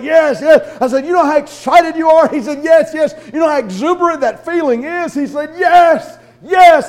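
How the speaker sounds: Barry getting fired up; building excitement. Retold or imitated.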